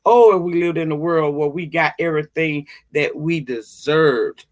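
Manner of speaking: normal talking voice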